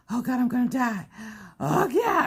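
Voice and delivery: milk the exhaustion like a tragedy; dramatic despair. Retold or imitated. Mom halfway up the cliff, wailing to her husband in mock despair. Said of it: "Stop being so dramatic".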